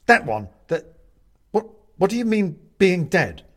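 high, loud, stammered question; shocked. A narrator's reading. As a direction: stumble into an alarmed demand. Benedict shocked to hear he's dead.

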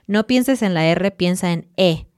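ordinary talk

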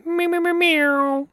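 high, slow meowing; cat meows. Pretend. Guest cheering with silly cat noises, high slow meows. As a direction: meow like a cat.

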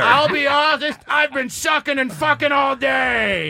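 Adam Sandler voice. Pretend. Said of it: "Adam Sandler caller continues".

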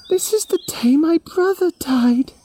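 put-on a little girl heartbroken over her brother; Yami grieving. A grieving girl answering the animals, high and sad. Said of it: "Yami shook her head and said".

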